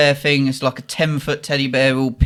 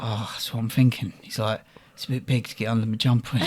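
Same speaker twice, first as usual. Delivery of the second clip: retold pondering; 6 semitones lower, 9 dB quieter than usual. Drunk Rick ponders stealing ice.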